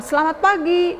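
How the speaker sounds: high, lilting imitated good morning; breezily innocent greeting. Hostess greets lodger after sleeping in, high innocent lilt. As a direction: greet as if nothing were amiss. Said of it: "seolah-olah kayak nggak ada salah".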